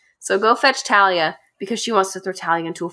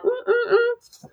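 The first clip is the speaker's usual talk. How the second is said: mock high-pitched humming; high smug humming; slower than usual. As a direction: hum it smugly, way up high. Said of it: "she's all like".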